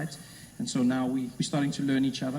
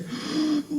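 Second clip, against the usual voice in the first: shocked gasp; 12 semitones higher than usual. Retold or imitated. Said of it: "She went pale".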